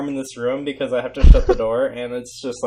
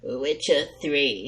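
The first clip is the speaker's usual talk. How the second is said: troll voice; 10 dB quieter, much slower than usual. Acted. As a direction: growl it like a troll. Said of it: "in a troll voice".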